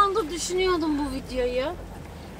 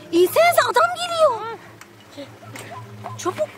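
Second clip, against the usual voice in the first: high, loud alarmed call; urgent shout; 10 semitones higher, 4 dB louder than usual. Real, not acted. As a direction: yell a warning. Urgent warning, man approaching.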